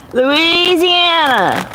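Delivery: slow, stretched-out Louisiana drawl, pitched high; drawn-out Louisiana accent. Shown as a demonstration. Streamer asked about her accent repeats her home state, exaggerated Louisiana drawl. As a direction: lay the Louisiana accent on thick and draw the word out.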